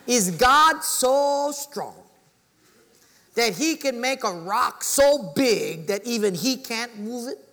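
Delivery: loud, high, drawn-out mock poser; skeptic's triumphant challenge. Skeptic thinks he had the pastor, loudly challenging. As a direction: imitate a doubter booming out a trick question. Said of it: "and he went like this".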